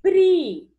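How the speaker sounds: pri called out high and loud; syllable called out. Shown as a demonstration. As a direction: sing out pri as one beat to the class.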